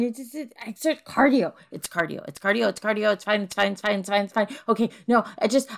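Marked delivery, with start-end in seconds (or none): none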